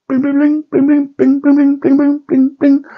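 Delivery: very high repeated bling; ping like a broken neon starter; high tinny bling-bling; imitated flicker sound.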